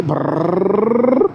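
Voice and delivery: rolled r engine rumble imitation. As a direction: roll the r like a revving engine. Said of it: "ये फटर फटर दे रहे हैं ना पीछे".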